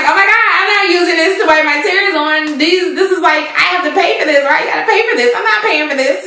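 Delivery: high, indignant squeal of refusal; Bethany's outraged protest. Retold or imitated. Bethany refuses the handkerchiefs, high and indignant. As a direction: push back like the price is an insult. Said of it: "And Bethany's like".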